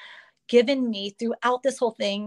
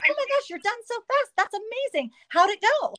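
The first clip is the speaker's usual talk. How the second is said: very high-pitched, chirpy amazement; acted happy astonishment; retold delighted surprise; 12 semitones higher than usual. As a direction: gush at the doctor, pitched way up. Retold delighted reaction to early finish.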